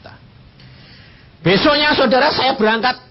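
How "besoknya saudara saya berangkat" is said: high, loud burst of preaching; loud sermon cry. Real, not acted. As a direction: lift the congregation with it. Preacher building to climax.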